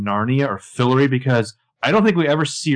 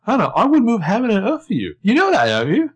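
high mock Jordan; 11 semitones higher, 4 dB louder than usual. Podcaster playing Jordan begging Hannah in a high whine. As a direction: whine it like a lost puppy.

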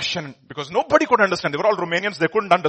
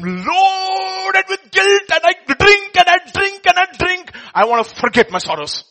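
anguished outcry; 11 semitones higher, 7 dB louder than usual. Retold or imitated. Former pastor confessing his guilt to Richard, anguished shout.

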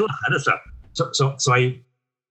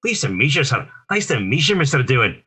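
Scottish brogue with a higher lilt; Scotty's Scottish accent; 4 semitones higher, 3 dB louder than usual. Acted. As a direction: greet your idol in his own Scottish burr. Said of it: "I knew that I had the voice".

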